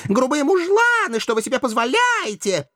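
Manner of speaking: very high, thin little voice; indignant river-maiden squeal; thin-voiced outcry. A narrator's reading. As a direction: wail in outrage in a tiny high voice. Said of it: "тонкими голосками возопили две речные жительницы".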